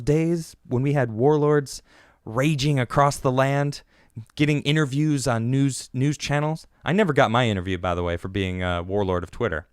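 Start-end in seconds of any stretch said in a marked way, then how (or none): none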